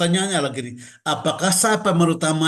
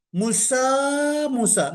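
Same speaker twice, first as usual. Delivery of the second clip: first "Musa" rising higher, second "Musa" falling; rising and falling tune; 8 semitones higher, slower than usual. Shown as a demonstration. Preacher showing the congregation how God's call sounds, up and down. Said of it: "naik turun".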